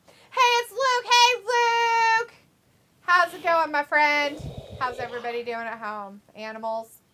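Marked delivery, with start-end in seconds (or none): 0.3-4.4 excited greeting. Real, not acted. Greeting Luke in chat.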